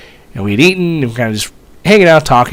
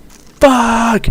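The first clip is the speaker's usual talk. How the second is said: retold freak-out curse; 11 semitones higher than usual. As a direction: shout it as if you just sat in it. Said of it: "he's like".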